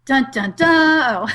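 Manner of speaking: slow, drawn-out "dun dun dun"; mock suspense sting. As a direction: intone a dramatic movie sting, last note long. Mock dread at embarrassing question.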